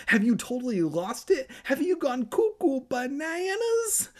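very high squealed disbelief, held to the end; hammed-up high-pitched freak-out; wailing. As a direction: climb into a hysterical mock wail. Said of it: "Evan wailed".

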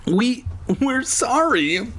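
high, slow, halting character voice; stammering apology. A narrator's reading. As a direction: falter on the first word, very high. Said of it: "Alex stammered".

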